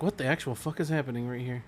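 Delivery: half to yourself; reading out own notes. Retold or imitated. Friend reading notes from the show, soft muttered disbelief. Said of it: "I'm like".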